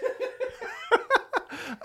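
high laugh. Real, not acted. Laughing after singing.

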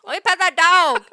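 loud, high, imitated child's yell; little girl demanding. Imitating a little girl who sees a bear, loudly demanding. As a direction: yell it like an insistent small child. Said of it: "a girl who's, like, four or five".

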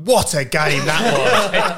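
very slow, savoured, with a laugh; delighted sigh. Retold or imitated. Mocking Connor's joy at a dull nil-nil, slow and delighted. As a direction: savour every word like it was a classic. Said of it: "turned around to them both and went".

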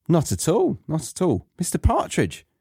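light chuckle, then a high polite invitation; teacher character. Acted. Teacher role answering Partridge in dialogue.

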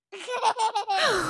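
breathless real giggle; giggling fit. Real, not acted. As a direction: laugh until out of breath. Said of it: "I need to catch my breath".

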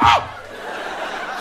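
high mock shriek of fright; scared scream. Comedian scared by screaming lady, high shriek. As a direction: scream right back in fright. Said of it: "she scared me".

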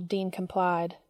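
usual speaking voice

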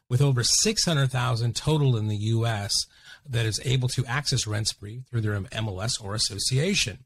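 soft, even sponsor read. Acted. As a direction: read the sponsor spot smoothly. Sponsor ad read over music.

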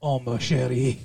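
low cartoon French accent; Pepe Le Pew. Shown as a demonstration. Showing off accents to co-host, low cartoon French accent. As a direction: croon it in a mock French accent. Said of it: "I can also do French".

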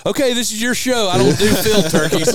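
high, loud snooty quote; snooty friend. Retold or imitated. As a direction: play it like a haughty snob. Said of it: "he looked at me and said".

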